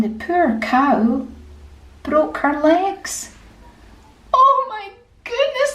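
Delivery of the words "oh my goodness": high, alarmed cry of dismay; storyteller's high voice of panic; shocked dismay. Acted. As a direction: cry out as if disaster just struck. Jack sees his cow's legs broken.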